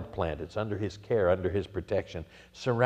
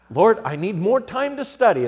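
shouted, higher and much louder; exasperated shout heavenward; loud cry to the Lord; 11 semitones higher, 9 dB louder than usual. Retold or imitated. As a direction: cry out the prayer in mock desperation. Prayer recalled with sudden force.

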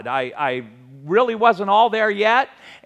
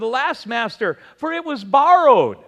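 rising cry, higher and louder at the end; 6 semitones higher, 4 dB louder than usual. Acted. Servant to his master over a lost axe head, crying out. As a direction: cry it out in distress, peaking on the last words. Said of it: "he cried out".

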